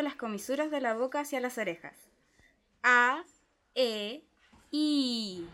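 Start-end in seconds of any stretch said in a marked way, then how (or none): 2.8-5.5 A, E and I drawn apart; vowel demo. Shown as a demonstration. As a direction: teach the three vowels clearly. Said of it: "Y la I acercando".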